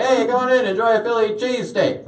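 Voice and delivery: high-pitched, put-on welcome; mock a chirpy restaurant host; restaurant greeter. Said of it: "You go in there like".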